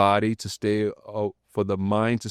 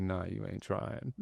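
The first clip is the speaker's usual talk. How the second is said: smooth and cool; 14 dB quieter than usual. Retold or imitated. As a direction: lay it on slow and easy, very soft. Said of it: "he was so smooth".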